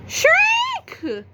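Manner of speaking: loud, high piercing shriek; Dad shrieking with a flaming marshmallow on his knee. Acted. Shriek sound effect.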